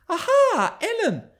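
high, loud gleeful cry; lightly voiced girl crying out gaily. A narrator's reading. Girl greeting her nurse, gleeful. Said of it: "she cried, gaily".

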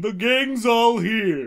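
drawn out line. Acted. Performing a line slowly.

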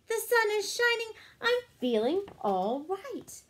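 bright, lively storybook reading; reading to children. A narrator's reading. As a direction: read it like a picture book for kids. Reading picture book aloud.

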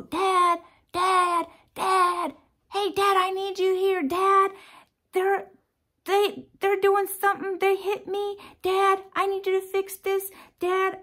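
put-on high, loud kids clamoring for Dad; nagging kids. Future grandkids going at their dad, loud nagging. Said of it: "those kids are gonna go".

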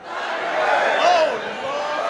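loud high cry; exclaimed. Real, not acted. Preacher to the crowd, a loud high holler. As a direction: holler it out with delight.

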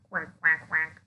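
put-on low duck quack for the kids. Shown as a demonstration. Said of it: "Yes, I am a bird".

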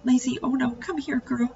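high, soft and tender; boy soothing his hurt dog, worried; tender worry. A narrator's reading. Boy comforts bitten dog.

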